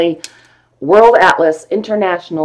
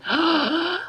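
soft, high gasp of delight; awed gasp; 11 semitones higher, 8 dB quieter than usual. Real, not acted. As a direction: gasp softly in admiration. Admiring the atlas pages.

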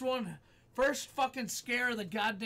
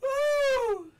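piercingly high relieved "woo"; real post-scare whoop; high loud whoop; 8 dB louder than usual. Real, not acted. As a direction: whoop the adrenaline out. Shaking off the jump scare.

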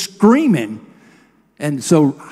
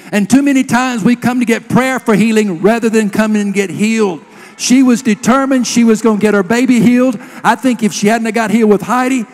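raised, driving preacher's voice; emphatic, higher-pitched preaching; 4 semitones higher, 4 dB louder than usual. Real, not acted. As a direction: press the point home to the congregation. Preaches to congregation.

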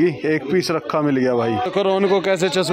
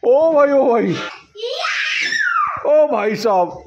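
amazed exclaim; 9 semitones higher, slower than usual. Real, not acted. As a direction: exclaim in disbelief.

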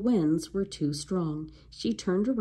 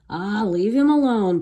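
growl; 3 semitones higher, 7 dB louder than usual. A narrator's reading. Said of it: "Pinky growled at Jack".

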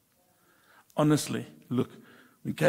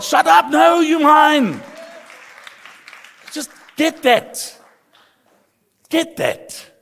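high, drawn-out full-force shout; shouted preaching; 11 semitones higher, 13 dB louder, slower than usual. Real, not acted. Preacher urges congregation to get God's love, slow high shouting. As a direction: bellow it slowly to hammer the point in.

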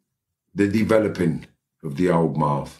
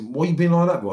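high-pitched aggrieved retort; acted protest; 12 semitones higher than usual. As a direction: play the other man objecting mid-row. Other man protests in quarrel.

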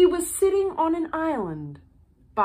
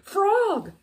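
higher, urgent cry; 7 semitones higher than usual. A narrator's reading. Toad crying out to Frog from the turtle. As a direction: call out to him, pleading. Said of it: "cried Toad".

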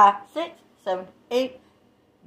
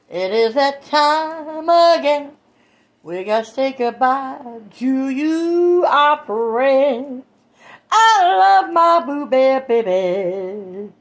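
slow sing-song farewell; 3 semitones higher, much slower than usual. Real, not acted. Slow sing-song goodbye to viewers.

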